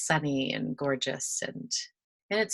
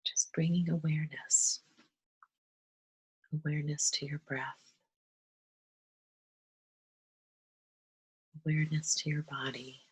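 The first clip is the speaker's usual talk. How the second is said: unhurried breath-led guidance, softening as it settles; guided meditation. Acted. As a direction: gently guide them through breathing, leaving long pauses.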